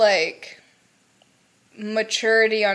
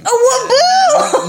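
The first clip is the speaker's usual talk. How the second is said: much higher, louder, slow laughing cry; gleeful shout; 7 dB louder, slower than usual. Pretend. Shouting the nickname at her cohost, gleeful. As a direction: belt the name out in delight.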